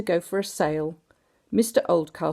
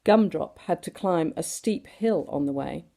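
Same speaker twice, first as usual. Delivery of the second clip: ordinary delivery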